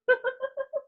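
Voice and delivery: a laugh that cracks into tears; tearful laugh. Real, not acted. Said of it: "I can't believe I'm crying like this".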